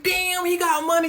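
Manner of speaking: high-pitched mock amazement; impressed onlookers. Impressed followers react to his money, high mock awe.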